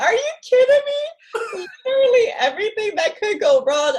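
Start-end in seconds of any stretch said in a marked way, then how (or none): 0.1-1.0 much higher and incredulous, retold; high-pitched retold "are you serious"; exasperated disbelief. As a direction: replay the moment the luggage was lost. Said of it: "I was just like".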